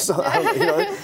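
laughing through the words, pitch jumping up. Real, not acted. Laughing at his own joke about a girl barking at kids. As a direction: chuckle as you try to carry on.

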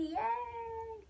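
high, stretched-out little "yay"; singsong celebratory yay; drawn-out cheer. Real, not acted. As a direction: cheer softly, high and long. Lucky viewers, drawn-out cheer.

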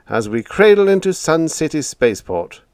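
high sarcastic finish. A narrator's reading. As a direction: land the irony. Sarcastic answer continues.

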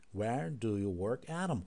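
very slow question. Shown as a demonstration. Teacher asking slowly so students can follow. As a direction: drag out each word for learners. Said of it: "yavaş soralım önce".

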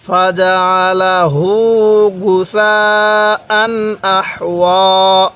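very slow drawn verse with long গুসাআন; slow recitation. Shown as a demonstration. Teacher leading the class, very slow chant. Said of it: "আরেকবার আমার সাথে পড়ুন".

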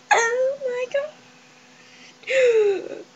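high, teary shriek of joy; overjoyed cry. Real, not acted. Girl finding the item she wanted, teary squeal. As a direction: squeal it out as if near tears. Overwhelmed by the find.

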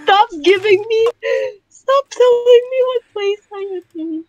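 mock crying. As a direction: whine it like a fake cry.

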